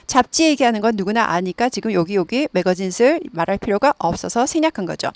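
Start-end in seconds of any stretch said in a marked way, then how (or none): none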